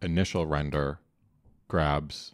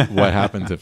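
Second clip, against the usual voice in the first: laughing; 5 semitones higher, 13 dB louder than usual. Real, not acted. Laughing at the boring joke.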